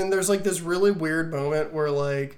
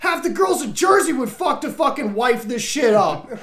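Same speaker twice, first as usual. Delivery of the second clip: mock quarrel; 5 semitones higher, 4 dB louder than usual. Acted quarrel between characters.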